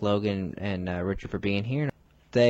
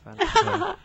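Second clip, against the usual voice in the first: squeaky enough. Acted. Comic high protest.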